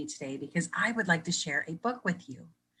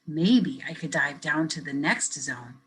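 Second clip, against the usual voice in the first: lively picture-book reading; reading to children. Acted. Teacher reading a picture book to kids, lively storytelling. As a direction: read it brightly for young listeners.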